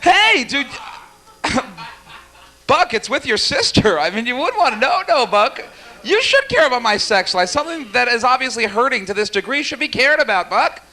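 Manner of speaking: high, whining plea; high whiny pleading. Acted. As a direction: whine at him like a hurt child. Mock pleading with heckler.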